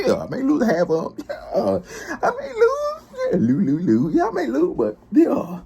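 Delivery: high, breaking laughing voice. Real, not acted. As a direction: crack up while admitting defeat. Laughing, squeaky high repeated "lose.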